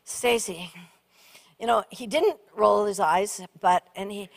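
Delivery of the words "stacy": lower, softer calm voice; calm husband. Retold or imitated. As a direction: calmly settle a panicking wife. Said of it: "relatively calmly".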